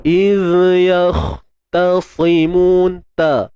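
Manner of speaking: teacher modelling يختصمون with a clean ta; correct Quranic recitation. Shown as a demonstration. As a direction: recite يختصمون keeping the ta light, not thickened. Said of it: "dia takkan jadi ta".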